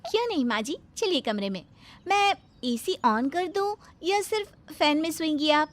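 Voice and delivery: high, syrupy and eager; sugary bahu voice. Acted. Bahu handing her mother-in-law the knife, sweetly eager. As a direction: innocent helpfulness, laid on thick.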